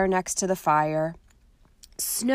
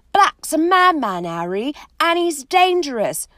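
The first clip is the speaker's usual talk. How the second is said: loud warning; 8 semitones higher, 7 dB louder than usual. Acted. Ron warning, loud and high.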